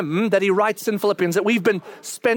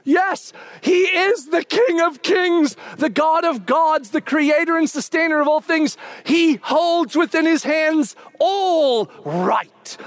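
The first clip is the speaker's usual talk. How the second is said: impassioned preaching; 6 semitones higher than usual. Real, not acted. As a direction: proclaim it grandly, stretching the big words. Preaches at full force.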